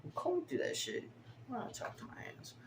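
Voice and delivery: breathy whisper to himself; half-whispered mumble to himself; whispered aside. Real, not acted. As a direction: whisper it like talking to your hands. Mutters his process to himself.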